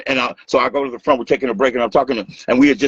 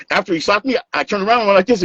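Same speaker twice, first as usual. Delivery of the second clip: usual speaking voice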